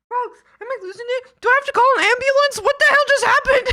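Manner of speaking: high, loud, fast panicked laughter; laughing shout. Real, not acted. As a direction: shriek it at chat in disbelief. Freaking out at chat.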